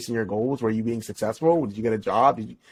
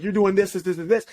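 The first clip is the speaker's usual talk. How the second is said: higher-pitched, repetitive mock bickering; mock emotional retort; 6 semitones higher than usual. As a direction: imitate a partner firing accusations back. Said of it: "guy's gonna get all emotional".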